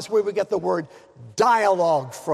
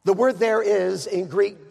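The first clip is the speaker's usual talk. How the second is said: ordinary delivery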